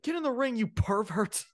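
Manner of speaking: high-pitched mock scolding; Corey Graves. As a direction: imitate a commentator heckling in outrage. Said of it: "freaking Corey Graves is funny".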